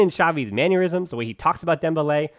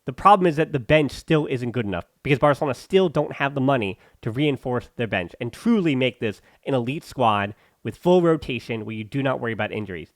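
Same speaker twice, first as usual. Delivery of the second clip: ordinary delivery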